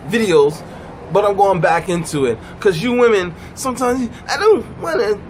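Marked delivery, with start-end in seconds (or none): 4.3-5.2 higher, whiny mock complaint; put-on tearful whine; mock whining. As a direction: whine in protest like a reluctant partner. Mocking women's whiny complaints.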